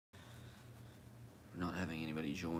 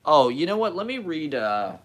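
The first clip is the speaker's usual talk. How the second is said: seized by a sudden idea, much louder and higher; sudden loud excitement; 9 semitones higher, 14 dB louder than usual. Real, not acted. Streamer suddenly decides to read to viewers, loud and excited. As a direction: burst out loud and high.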